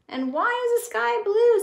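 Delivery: very high, loud puzzled voice; curious crayon. Acted. Blue crayon asking a silly question, high-voiced. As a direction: ask it wide-eyed. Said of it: "said the blue crayon".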